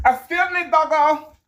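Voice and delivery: startled owner calling the dog; high call to the dog. Real, not acted.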